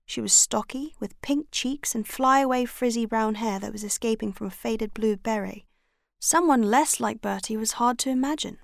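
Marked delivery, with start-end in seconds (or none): none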